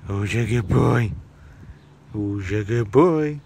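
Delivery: low, drawn-out cooing to a pet; slow doting talk to a tortoise. Real, not acted. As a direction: stretch it out low, fussing over a pet.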